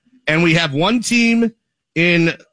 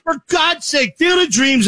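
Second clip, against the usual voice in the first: high exasperated outburst; exasperated shout; 8 semitones higher than usual. Real, not acted. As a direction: throw up your hands and shout it. Outraged at picks.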